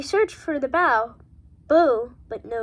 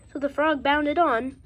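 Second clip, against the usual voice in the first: plain reading voice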